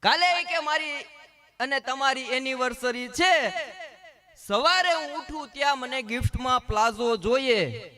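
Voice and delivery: a wife sweetly insisting on her anniversary gift; demanding wife. Retold or imitated. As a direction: make the demand like a spoiled wife.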